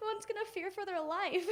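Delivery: high voice breaking into laughter; laughing. Real, not acted. Storyteller amused by her sister's threats, laughing as she talks. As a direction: say it through real giggles.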